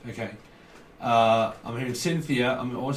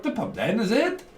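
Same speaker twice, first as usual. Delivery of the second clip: bad mock Welsh accent, rising high at the end; half-hearted Welsh lilt, "is it" jumping up; 8 semitones higher than usual. Reader having another go at Welsh, mock Welsh accent. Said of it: "not very good at the old Welsh accent".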